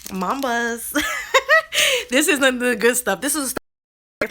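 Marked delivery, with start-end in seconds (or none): 1.0-2.0 a high giggle caught while eating. Real, not acted. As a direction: break into a quick high giggle. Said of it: "Girl, what are you eating?".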